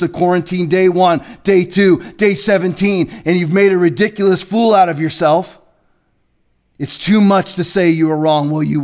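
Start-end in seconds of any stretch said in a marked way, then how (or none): none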